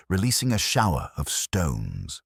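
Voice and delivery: ordinary delivery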